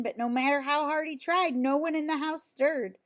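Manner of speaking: ordinary delivery, nothing marked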